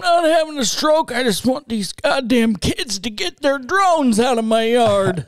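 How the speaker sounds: put-on strained old-codger grumble; cranky old man protesting, voice squeezed; stressed-out old man. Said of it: "It sounds like they're stressed out".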